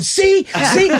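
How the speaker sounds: high loud triumphant see, see. Retold or imitated. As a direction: gloat that you were right. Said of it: "I'm going".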